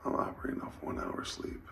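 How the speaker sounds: hushed whisper so she sleeps; whisper, someone sleeping nearby. Real, not acted. Whispering while partner sleeps.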